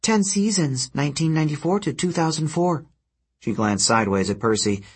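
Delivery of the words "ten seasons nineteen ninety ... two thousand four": high-pitched creature reeling off facts; harpy trivia recital. A narrator's reading.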